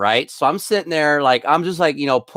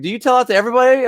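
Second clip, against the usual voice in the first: high, fast, amused question; amused customer; 9 semitones higher, 4 dB louder, much faster than usual. Retold or imitated. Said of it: "She's like".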